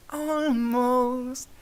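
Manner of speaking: string him along playfully; teasing almost. A narrator's reading. Luna teasing, delighted.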